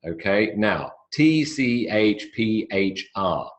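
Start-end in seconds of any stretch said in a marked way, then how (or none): 1.1-3.5 each letter slow and separate; spelling out letters slowly. Shown as a demonstration. Teacher to class, slowly spelling letters. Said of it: "these letters belong together".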